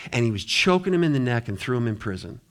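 usual speaking voice

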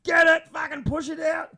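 mock coaching shout; retold yelling. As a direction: yell at him to push it out. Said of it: "Schmidt be like".

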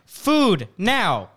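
high, emphatic dog demand, word by word; insistent button dog. Retold or imitated. Dog insists again via buttons.

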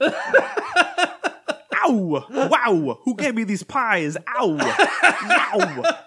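high, loud laughing Walken yelps; Walken eating pies. Pretend. Playing Walken double-fisting pies for his partner, high and laughing. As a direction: whoop it up with a pie in each hand.

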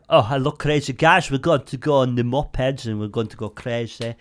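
lilting Icelandic impression; excitable tour guide with an Icelandic accent. Snowmobile man greeting his tour group, Icelandic accent. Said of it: "Whereas my Icelandic accent".

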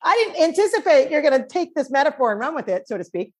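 playing her amused dad; father's voice. Quoting her father's reply.

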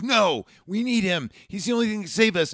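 lightly voiced John pleading; urgent kid. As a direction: plead fast and high like a kid. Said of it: "John ... goes".

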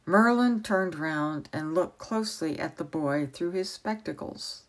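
usual speaking voice

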